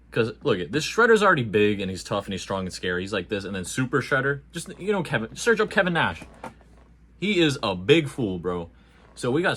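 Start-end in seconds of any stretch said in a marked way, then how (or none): none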